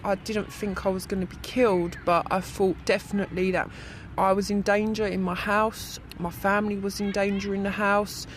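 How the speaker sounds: ordinary talk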